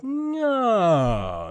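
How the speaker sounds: siren from high sliding down; vocal warm-up slide. Shown as a demonstration. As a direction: start high and glide all the way down. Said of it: "but you go like".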